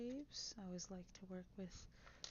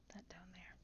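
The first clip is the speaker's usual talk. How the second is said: whispering; 10 dB quieter, faster than usual. Real, not acted. Quiet building whisper.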